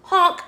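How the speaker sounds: high, loud honk; goose honk. A narrator's reading. Goose afraid of lightning, loud honk.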